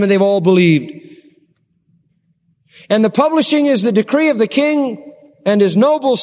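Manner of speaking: loud, high preacher's proclamation; preaching at full force. Acted. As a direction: proclaim the decree to the whole city. Preaching the king's decree.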